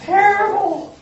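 loud, high outburst of indignation; exclaiming. Real, not acted. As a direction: throw the word out in outrage. Exclaiming about slander by Christians.

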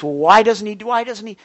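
loud, high mock complaint; impatient complaint. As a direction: whine it like an impatient churchgoer. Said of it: "some folks".

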